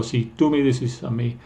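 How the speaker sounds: usual speaking voice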